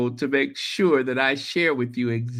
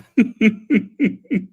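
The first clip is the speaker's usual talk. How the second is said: delighted laugh; 7 semitones higher, 6 dB louder than usual. Real, not acted. Laughing about exclusive mug.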